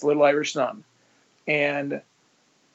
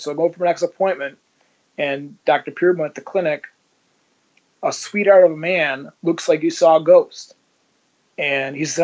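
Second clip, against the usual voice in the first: normal talking voice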